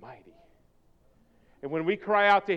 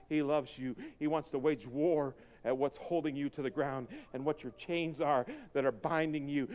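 usual speaking voice

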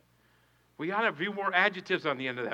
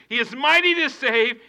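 high, louder proclaimed scripture easing at the end; preached verse; 7 semitones higher, 10 dB louder than usual. Acted. Pastor reading Zephaniah again to the congregation. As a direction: lift the verse, then settle.

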